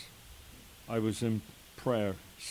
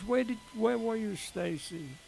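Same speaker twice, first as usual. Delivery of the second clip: much higher, urgent and pleading; urgent emotional question; 10 semitones higher than usual. Retold or imitated. Father urgently asking his daughter where she went, emotional. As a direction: demand to know, overcome.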